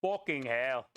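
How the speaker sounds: rough British swearing, hammed-up English accent; bad English accent. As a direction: play a self-mocking Gordon Ramsay in a British accent. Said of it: "I do a horrible English accent".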